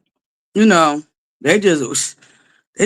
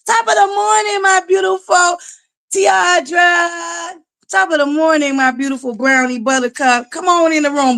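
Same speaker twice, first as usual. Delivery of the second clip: excited greeting; 8 semitones higher than usual. Real, not acted. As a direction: greet her with a high excited call. Greeting new viewer loudly.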